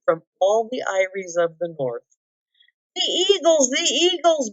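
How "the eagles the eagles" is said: high joyful calling; excited cry. A narrator's reading. Bilbo dancing and waving at the elves, excited cry. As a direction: wave and call out with delight. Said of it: "Bilbo cried".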